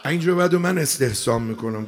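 normal talking voice

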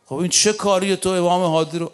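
ordinary delivery

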